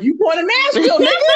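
laughing, delighted friend asking twice; excited teasing quote. Retold or imitated. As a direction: squeal it in delight, climbing to the end. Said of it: "She was like".

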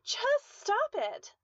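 high, slow, loud drawn-out scream; desperate yell. A narrator's reading. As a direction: scream each word, stretched. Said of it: "she yelled".

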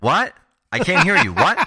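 loud, high shouted question; pretend hard of hearing. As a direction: play deaf and holler for a repeat. Pretends deafness as a joke on Carlos.

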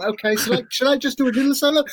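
high, fast hopeful whine; pleading Kirk. Retold or imitated. Kirk pushing again for a little solo, high fast mock plea. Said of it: "And he's like".

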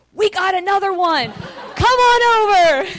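high, loud, slow call with a laugh; gleeful call. Retold or imitated. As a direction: call them over, laughing with joy. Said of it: "She's like".

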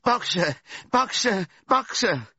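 repeated high, loud calls; desperate cry. A narrator's reading. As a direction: keep calling after the departing van. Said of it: "she cried".

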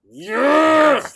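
high, loud triumphant yell; excited yes. Retold or imitated. Guest reliving the moment before Ninja Warrior, a loud excited yell. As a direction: shout it like you're fired up. Retold psyching up before the course.

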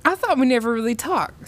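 quick, high-pitched retort; pert, speedy comeback from a girl; shot back. A narrator's reading. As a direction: fire it back fast and high. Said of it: "Sam shot back".

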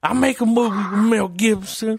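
Tracy Morgan voice. Pretend. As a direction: brag in a squeaky comic voice. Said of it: "I want my phone to be like".

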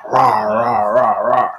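a growly mock roar, rah rah; imitated werewolf roar. Podcaster imitating the werewolf's roar, a growly mock roar. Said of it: "this roar".

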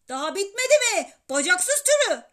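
loud, high, furious scolding; angry shout. A narrator's reading. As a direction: yell at a pesky kid on the phone. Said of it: "diye bağırdı babam. Sonra devam etti". (öfkeyle bağırıp azarlayarak)

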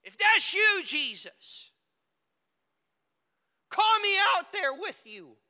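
loud, high shout across water; bold calling out. Acted. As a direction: holler it from a boat into the storm. Peter calls to Jesus over waves.

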